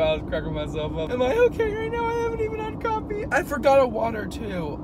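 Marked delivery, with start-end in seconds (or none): 1.1-4.8 much higher, louder giddy voice; bubbling over, laughing at herself; giddy excited. Real, not acted. Giddy rant in car.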